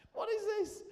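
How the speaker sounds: very high, loud mock outburst; imitated indignant yell, pitched right up; outraged shout. Heckling mid-wedding about the hair, high indignant shout.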